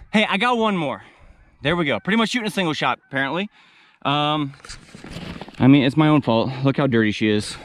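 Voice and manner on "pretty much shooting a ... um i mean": usual speaking voice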